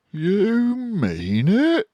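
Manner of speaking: can't believe his luck; surprised cry. A narrator's reading. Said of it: "cried Badger".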